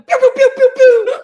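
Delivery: high, loud pew pew noises; loud cartoon zapping noises; playful pew sound effects. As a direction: shoot out zippy speed sounds. Imitating zooming past defenders.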